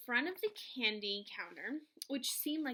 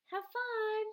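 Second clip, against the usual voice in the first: higher, brighter goodbye; Mom waving; 10 semitones higher, 4 dB louder than usual. A narrator's reading. Mom waving her son off at school, higher. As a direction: toss it off as a cheery mom.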